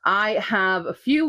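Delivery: ordinary talk, nothing marked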